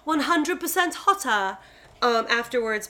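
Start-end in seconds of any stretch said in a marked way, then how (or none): 0.0-1.6 slow, imitated British title announcement; British accent. Naming the makeover show, British accent. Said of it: "say it in a British accent".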